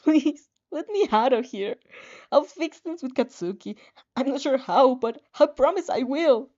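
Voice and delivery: high, loud, desperate plea; desperate pleading. Acted. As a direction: plead to be let out. Begging the system for release.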